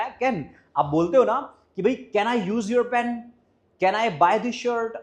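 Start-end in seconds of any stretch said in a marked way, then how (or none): 1.8-4.9 drawn-out slow model sentence; slow wrong example. Shown as a demonstration. Teacher slowly voicing the wrong phrasing to students. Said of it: "आज के बाद can का use करना बंद कर दो".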